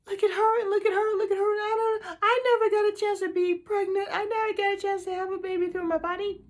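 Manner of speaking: high-pitched, weepy self-pity; acted tearful lament. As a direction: wail it high, imitating your own despairing thoughts. Acts out her own hopeless thoughts.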